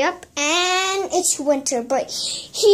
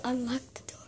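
whispering; 6 semitones lower, 15 dB quieter, faster than usual. Real, not acted. Hiding from seeker, whispering.

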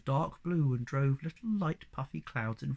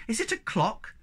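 high, wondering question; exclaiming; 7 semitones higher than usual. A narrator's reading. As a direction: ask in eager wonder. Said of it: "exclaimed the Snork Maiden".

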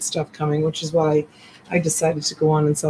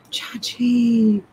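unvoiced whisper, slightly higher; 6 semitones higher than usual. Real, not acted. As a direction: whisper as if people are sleeping. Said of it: "I'm whispering like there's people".

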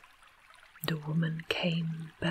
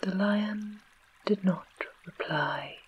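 ordinary delivery

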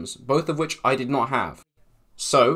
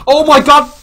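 very high, loud shriek; shocked scream; 16 dB louder than usual. Real, not acted. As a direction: shriek at a sudden scare. Sudden creepers appear.

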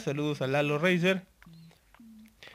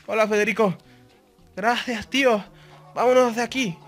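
higher, louder fake Castilian accent; mock Spanish accent; 6 semitones higher, 6 dB louder than usual. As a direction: ham up a Spain Spanish voice. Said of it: "hacer ese acento español".

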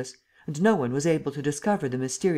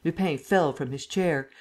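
plain reading voice

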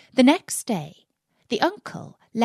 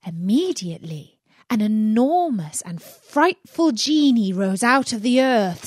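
plain reading voice

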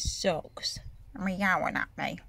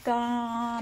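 ordinary delivery

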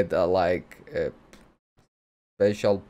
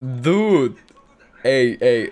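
loud real cry of Dude!; shocked outburst; 8 dB louder, much slower than usual. Real, not acted. Viewer yelling at a shocking anime twist, loud and shocked. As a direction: blurt it out in shock.

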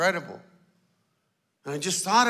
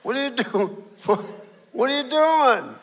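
Spanish-tinged yell at a stranger; Miami guy; 3 semitones higher than usual. Retold or imitated. Miami owner shouting at a man taking his truck, Cuban accent. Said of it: "the guy in Miami comes out and he goes".

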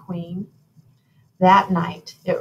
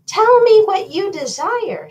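high, louder, sing-out fairy godmother; 13 semitones higher, 7 dB louder than usual. A narrator's reading. Fairy godmother asks the royal couple their desire, high godmother voice.

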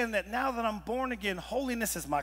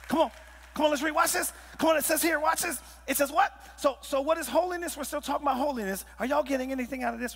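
fired-up preaching; 6 semitones higher, faster than usual. Acted. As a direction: lift the room as you read the verse. Preacher reading scripture, fired up.

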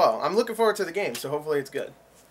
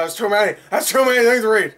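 exasperated outburst; 6 semitones higher, 7 dB louder than usual. Real, not acted. Overwhelmed by trailer award text.

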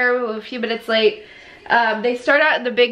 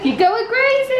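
high-pitched teasing excitement; playful exclamation; 10 semitones higher than usual. Real, not acted. Teasing a hyper child.

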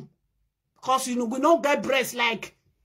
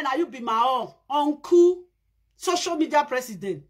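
ordinary talk

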